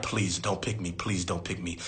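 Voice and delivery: soft, low, begging under the breath; desperate whisper plea. Retold or imitated. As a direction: pray not to be called on, almost silent. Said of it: "I used to literally be like".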